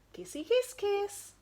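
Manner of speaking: high squeaky kissy voice; silly smooching; kissing noise. Acted. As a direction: make the kiss sound playful and squeaky. Kiss sound effect.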